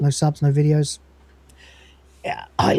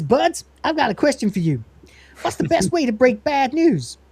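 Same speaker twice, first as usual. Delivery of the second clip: higher, perky cat voice; 7 semitones higher than usual. Acted. The Cat asks the crew for advice, perky and high. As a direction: play the Cat, eager and curious. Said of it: "the cat walks in and says".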